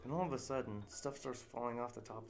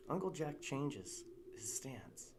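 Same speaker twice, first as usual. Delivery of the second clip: normal talking voice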